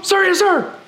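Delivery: high sir yes sir; cadet answering. Retold or imitated. Cadet answering drill instructor.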